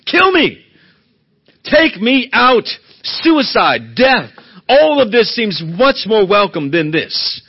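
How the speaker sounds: loud, desperate plea to die; anguished cry. Acted. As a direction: cry out like a man begging for death. Jonah's despairing plea voiced.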